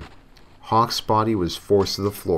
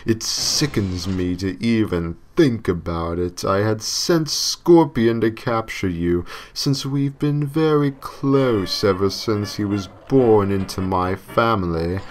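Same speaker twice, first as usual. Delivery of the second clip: slow, louder, distorted devil voice; demonic voice; 2 semitones higher, 3 dB louder, slower than usual. Acted. The devil explaining Hawk's punishment, slow demonic voice. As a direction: lay out the cruel sentence with relish. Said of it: "The devil continued".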